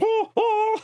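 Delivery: very high, comic imitation scream; mock scream. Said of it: "like, start screaming".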